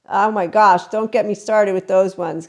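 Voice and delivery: usual speaking voice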